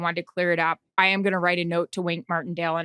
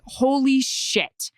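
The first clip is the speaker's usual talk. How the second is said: slow, drawn-out stunned gasp of words; stunned holy shit; 4 semitones higher, much slower than usual. Retold or imitated. Host realizing a live on-air misquote, slow and stunned. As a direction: let the panic sink in slowly.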